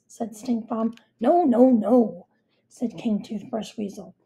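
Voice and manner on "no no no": louder, insistent no; loud insistence. A narrator's reading. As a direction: shut it down firmly. King loudly contradicting.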